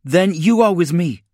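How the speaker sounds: loud, high-pitched command; ordering. A narrator's reading. Spinoza takes over the troopers, ordering loudly. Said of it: "Spinoza ordered".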